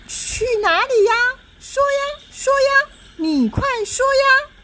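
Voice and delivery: high-pitched demanding doll; pushy little doll. Acted. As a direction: nag him in a tiny high voice. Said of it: "手叉腰说".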